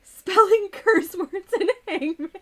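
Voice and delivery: reading through loud laughter; high, loud laughing read. Real, not acted. Laughing while reading bingo idea.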